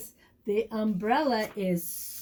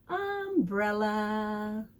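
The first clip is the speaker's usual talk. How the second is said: slow drawn-out word; much slower than usual. Acted. Ending story with word.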